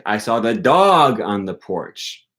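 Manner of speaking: dog stressed, slow. Shown as a demonstration. Teacher shows stress on dog, not the cat. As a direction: hit the word dog. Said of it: "I didn't see the cat".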